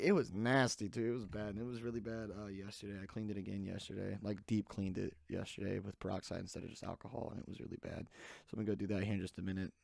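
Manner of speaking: ordinary talk, nothing marked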